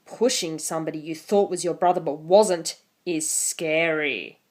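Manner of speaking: ordinary talk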